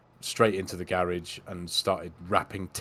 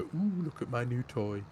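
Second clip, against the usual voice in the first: higher-pitched cooing wonder; mock delight; 5 semitones higher, 4 dB quieter than usual. Finally opening the box, playful mock delight. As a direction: coo over a brand-new toy.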